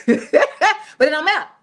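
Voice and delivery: amused, laughing delivery. Real, not acted. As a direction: chuckle the words out. Laughs through the punchline.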